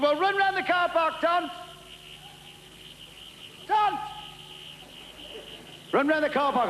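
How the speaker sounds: high, slow call out of the pub window. Acted. Lone Ranger yells from window.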